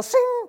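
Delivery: loud, piercingly high blade sound; imitated sword-drawing sound. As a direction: draw an imaginary sword with your voice. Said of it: "We're pulling out the sword of the Spirit".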